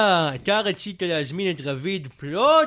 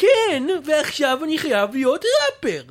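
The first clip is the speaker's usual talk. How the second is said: heated argument; 4 semitones higher than usual. Acted. Fake rapper insulting the host in a heated argument. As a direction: snap back at him, offended.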